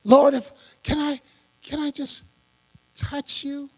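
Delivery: halting mock admirer, fading very soft; hesitant, hushed little plea, put on; starstruck follower. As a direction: imitate a timid fan asking a favor. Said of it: "doing the same thing about Jesus".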